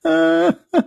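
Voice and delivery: high burst of laughter; giggling fit. Real, not acted. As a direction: laugh again, unable to stop. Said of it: "Sorry, I cannot".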